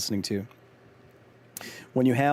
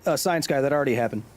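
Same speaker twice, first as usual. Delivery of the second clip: ordinary delivery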